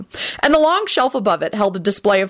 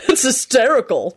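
enthusiastic; 6 semitones higher than usual. Acted. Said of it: "George corrected her enthusiastically".

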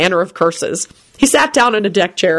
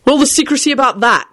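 higher, irritated snap; snapping angrily; 7 semitones higher than usual. A narrator's reading. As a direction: bite the question off. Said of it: "Quentin snapped angrily".

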